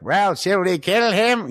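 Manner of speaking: high, slow goblin question; hammed-up pondering goblin; mock goblin voice. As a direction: ask it slowly in a squeaky goblin pitch. Goblins quoted deciding.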